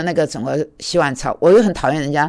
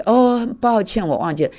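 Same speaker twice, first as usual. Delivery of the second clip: the same gentle excuse, now in Chinese; gentle apology; 7 semitones higher than usual. Retold or imitated. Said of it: "他讲话是很温柔的".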